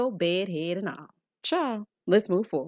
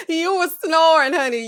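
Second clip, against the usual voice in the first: loud, high mock accusation; raised-voice playful teasing; teasing exclamation; 7 semitones higher, 7 dB louder than usual. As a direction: burst out with it, fresh from a laugh. Acts suitors teasing her snoring.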